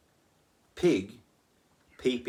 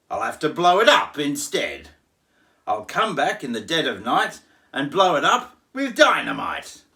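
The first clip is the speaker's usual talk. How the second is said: louder wolf plotting; scheming wolf; 5 semitones higher, 6 dB louder, faster than usual. Acted. Wolf vows to blow up the house, loud. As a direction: growl out the plan.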